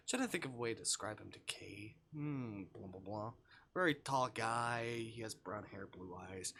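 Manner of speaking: usual speaking voice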